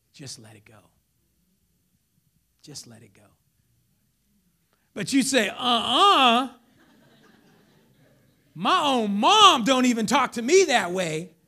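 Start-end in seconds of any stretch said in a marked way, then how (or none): 0.1-0.8 very low, hushed inner voice; Holy Spirit's quiet voice. Acted. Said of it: "you hear this voice". 2.6-3.3 soft, low prompting; Holy Spirit's quiet voice. Acted. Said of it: "you hear this voice". 5.5-11.4 loud, sassy refusal; indignant comeback. Retold or imitated. Acting out an offended shopper.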